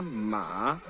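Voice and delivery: "ma" very low and drawn out, lifting at the end; deep dipping Mandarin third tone on "ma". Shown as a demonstration. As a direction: sink to your lowest pitch on "ma". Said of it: "A gente teria ma".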